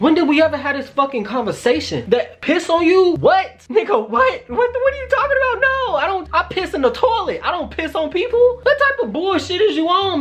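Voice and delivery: very high-pitched, incredulous ranting; aggressive outrage. Retold or imitated. Retelling his reaction to the hookup's request, aggressive outrage. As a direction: rant in squealing, hammed-up indignation. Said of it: "I got so aggressive".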